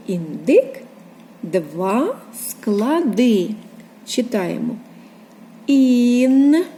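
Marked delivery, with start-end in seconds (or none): 5.7-6.6 Ін stretched long on a level note; isolated first syllable. Shown as a demonstration. Teacher marking the first syllable, Ін drawn out. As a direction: hold both sounds of Ін. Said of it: "Перший склад".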